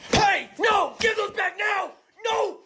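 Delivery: high, loud yells to give them back; outraged shout. Acted. Shouting at a friend making a sandwich from his cards, outraged yell. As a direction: scream at him to hand them over.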